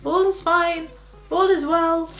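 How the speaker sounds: high, strained pretend-everything's-fine voice; badly faked breezy reassurance; falsely reassuring. Student saying to parents that all is fine, falsely reassuring. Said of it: "saying to your parents".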